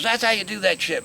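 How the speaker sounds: high, loud mock boast; triumphant boast. Friend has just demonstrated snorting to the newcomer, triumphant. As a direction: crow it like a buzzed friend.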